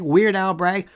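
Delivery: normal talking voice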